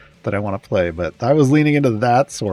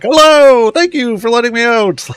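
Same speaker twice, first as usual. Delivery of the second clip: high, loud, chirpy sword voice; squeaky cheerful little sword; Sortle's voice; 12 semitones higher, 7 dB louder than usual. Retold or imitated. Player voicing his sword bard to rescuers, high and bright. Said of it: "and then I'm like".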